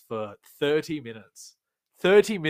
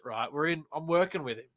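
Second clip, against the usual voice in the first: normal talking voice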